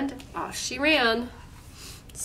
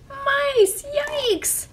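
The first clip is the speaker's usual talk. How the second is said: very high, squeaky shriek; 4 dB louder than usual. Acted. Guard shrieking when the mice appear. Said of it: "the guard shrieked".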